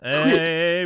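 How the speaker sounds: ordinary delivery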